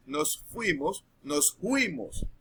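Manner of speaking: "nos fuimos" with the F softened to a J-like sound, slowly; "nos fuimos" slow, F turned to a breathy J; coastal peasant "nos fuimos". Shown as a demonstration. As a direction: say "nos fuimos" as a coastal country speaker, F becoming J. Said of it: "como cuando dicen en vez de".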